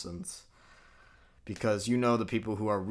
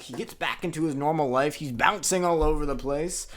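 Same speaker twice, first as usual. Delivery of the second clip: normal talking voice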